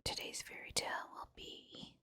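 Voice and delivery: ordinary delivery, nothing marked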